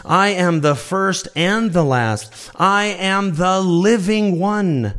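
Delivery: slow, weighty quote of Jesus; emphatic scripture quote. Acted. Preacher slowly declaring Jesus' words to the congregation, weighty. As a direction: lean on each word of Jesus' words. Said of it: "he says this".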